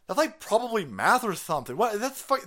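high, loud mocking of scoffing panelists; mocking climate activists. Mimicking activists scoffing at the man's question, high and loud. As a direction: play the dismissive activists as airheads. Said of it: "And they go".

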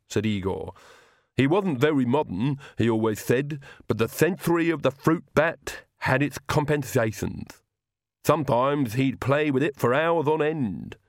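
Igor voice. Acted. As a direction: speak like a devoted old servant. Igor speaks in character.

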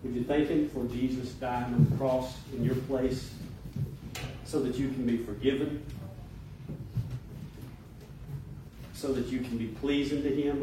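hushed, measured invitation to prayer; gentle altar call. Acted. Pastor inviting congregation to pray, gentle and hushed. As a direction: ease them softly into prayer, pausing between phrases.

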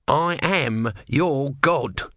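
very slow, grand god voice; solemn tortoise god. Acted. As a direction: declare it with weary grandeur. Tortoise declares.

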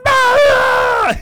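sudden wild scream, high and loud; mock anguished scream. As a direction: belt out a hammy scream of pain. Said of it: "'cause he's just like".

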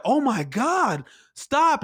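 high-pitched mock scream, drawn out; retold screaming. Imitating shocked people at a meltdown, mock scream. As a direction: mimic a horrified crowd without really yelling. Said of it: "People were screaming and like".

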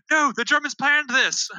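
high, drawn-out acted protest; desperate protest. Messenger warning the commander about the Germans, desperate protest. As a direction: insist slowly at the top of your range. Messenger argues to stop attack.

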